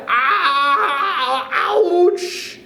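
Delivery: high yelping cries of pain. A narrator's reading. Startled husband yelping in pain as his wife lands, high yelps. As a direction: squeal like stung by a wasp. Mr Twit hit in shock.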